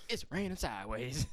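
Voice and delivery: slow, hammed-up forecast; weatherman voice. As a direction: drag it out like a dramatic forecaster. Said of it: "with the weather".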